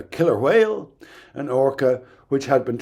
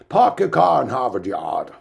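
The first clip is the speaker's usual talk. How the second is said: demonstrated Boston accent with dropped r's; 4 dB louder than usual. Shown as a demonstration. Lecturer admits being a poor example, attempts a Boston accent. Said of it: "is an example of a Boston accent".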